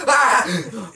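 high, loud startled yell on waking; startled scream. Retold or imitated. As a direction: cry out as if jolted awake. Waking from the nightmare.

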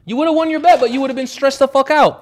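very high, loud protest; worked-up arguing, voice soaring; heated rebuttal. Real, not acted. Heated rebuttal echoing friend's words.

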